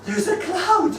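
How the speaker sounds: put-on wide-eyed three-year-old sharing a discovery; small daughter. Daughter describing her first fog, high and delighted. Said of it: "she said".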